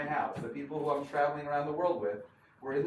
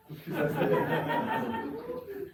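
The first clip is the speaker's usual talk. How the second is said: big high laugh. Real, not acted. After cab driver thanks God.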